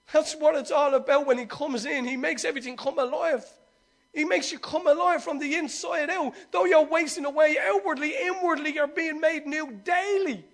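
high, loud, swelling preaching; fervent preaching. Acted. Pastor lifting the congregation about new life, fervent high preaching. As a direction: preach it up to the rafters.

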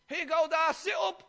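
high, eager call to dad; eager greeting. Acted. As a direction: call out brightly as you bring the food. Esau brings stew to dad.

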